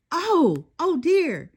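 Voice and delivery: startled, realise you're late; flustered. A narrator's reading. Late girl jumps up in a fluster, flustered.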